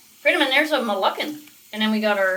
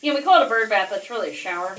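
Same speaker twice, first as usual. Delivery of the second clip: normal talking voice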